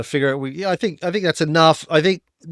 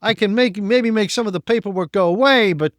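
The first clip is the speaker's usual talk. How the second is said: put-on higher, sly Italian wiseguy accent; 3 semitones higher than usual. Customs guard hinting at a bribe, Italian wiseguy accent. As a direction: lean in like a crooked official. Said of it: "and he goes".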